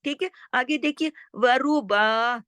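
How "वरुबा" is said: long वरुबा, stopped in the throat; pause on ain. Shown as a demonstration. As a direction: draw out वरुबा slowly and halt on a throaty ain. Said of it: "ऐन पे मैं रुक गई हूँ". (वरुबा को ऐन पर रोककर)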